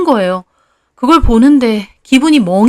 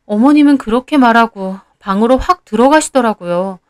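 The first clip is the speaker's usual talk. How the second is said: usual speaking voice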